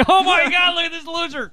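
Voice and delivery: high, mocking, scornful voice. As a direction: sneer it out, high and mean.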